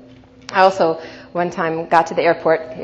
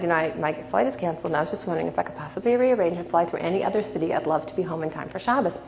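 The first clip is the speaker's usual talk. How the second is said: calm and much softer; 8 dB quieter, faster than usual. Retold or imitated. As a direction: ask gently, no fuss at all. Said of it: "calmly said".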